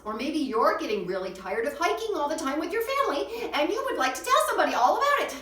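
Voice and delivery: building from emphatic to high, loud venting; exasperated outburst. Acted. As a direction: let mock exasperation swell into a fast, high yell. Acting out being fed up with hiking.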